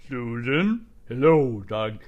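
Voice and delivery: loud, booming God voice; a lightly voiced movie God, loud; deep God voice. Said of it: "he probably talked lower".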